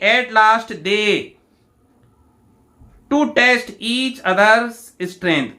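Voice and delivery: very slow, each word set apart for students; slow dictation reading. Shown as a demonstration. Teacher reads the question to students, slow and deliberate. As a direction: read it out like dictation to the class.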